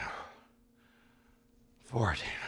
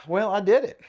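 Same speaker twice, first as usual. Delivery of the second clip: panting after the workout; out of breath; 9 semitones higher, 5 dB louder than usual. Real, not acted. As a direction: speak between breaths. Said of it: "I'm definitely out of breath".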